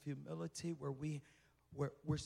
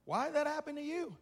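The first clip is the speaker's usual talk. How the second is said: much higher, louder pointed question; mocking accuser; 12 semitones higher, 11 dB louder, faster than usual. Mock accuser, raised.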